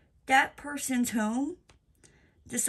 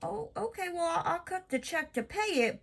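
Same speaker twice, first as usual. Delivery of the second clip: sarcastic oh okay; 9 semitones higher than usual. Retold or imitated. Mocking reply to supply yard.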